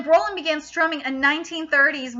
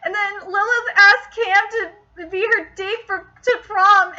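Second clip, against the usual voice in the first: usual speaking voice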